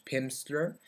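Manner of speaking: ordinary talk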